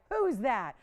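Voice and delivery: loud, rising mock outrage; mock indignant callers. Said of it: "they'd probably be like".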